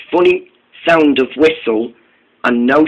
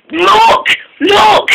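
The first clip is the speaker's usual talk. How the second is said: high shouted look look; Layla's shrill alert, much higher; excited shout. A narrator's reading. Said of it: "shouted Layla".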